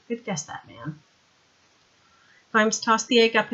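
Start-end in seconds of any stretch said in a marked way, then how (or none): 0.1-1.0 much softer, lower murmur; weak, faint. Acted. Vimes, shaken, answering Sandra faintly. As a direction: let it fade out of him. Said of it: "said Vimes weakly".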